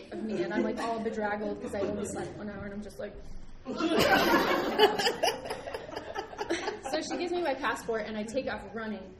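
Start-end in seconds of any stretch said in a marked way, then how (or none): 3.2-6.9 long airy laugh; breathy laugh. Real, not acted. Laughing at own story.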